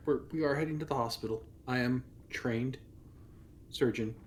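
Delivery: ordinary talk, nothing marked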